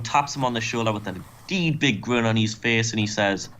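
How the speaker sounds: ordinary talk, nothing marked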